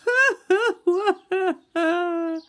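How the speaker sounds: high, loud mock wail; high wailing sob. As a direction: sob as Little Beaver. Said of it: "said Little Beaver".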